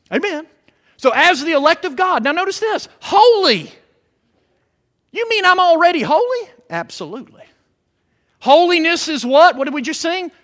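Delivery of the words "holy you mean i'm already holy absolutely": ordinary talk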